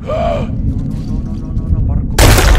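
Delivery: breathless panicked denial. Real, not acted. Gamer cornered by enemies, panicked. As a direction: mutter no over and over in panic.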